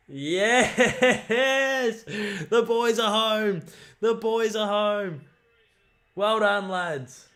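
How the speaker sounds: high, slow, loud victory cry; jubilant shouting. Real, not acted. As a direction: roar it like the winning goal. Goal after the siren.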